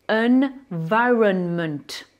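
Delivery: "environment" very slow, each N held; sounded English N. Shown as a demonstration. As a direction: stretch English "environment" syllable by syllable, sounding every N. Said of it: "là, j'ai prononcé". (« environment » très lentement, N bien prononcés)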